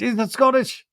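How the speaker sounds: lightly put-on high questioning voice; mock eager question. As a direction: imitate someone piping up with a question. Repeats question as Danny's.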